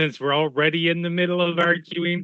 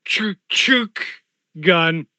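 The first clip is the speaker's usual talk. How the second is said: gun cocking noise; 2 semitones higher, slower than usual. Pretend. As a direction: mimic racking a shotgun.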